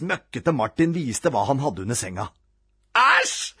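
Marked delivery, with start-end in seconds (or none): 3.0-3.5 high yelp of disgust; disgusted shriek. A narrator's reading. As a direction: cry out, delightedly grossed out. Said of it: "skrek rampete Robin".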